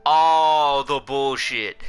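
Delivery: "All" boomed out and held long; for the cheap seats. Real, not acted. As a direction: project a loud, drawn-out "All" to the back rows. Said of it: "say that again for the people in the cheap seats".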